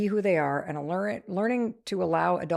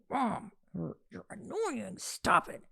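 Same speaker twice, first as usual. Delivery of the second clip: soft, half-whispered scold; hushed scolding; 7 dB quieter, slower than usual. Retold or imitated. Daughter grabbing mom's arm, hushed scold. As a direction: shush her under your breath. Daughter hushing her mom.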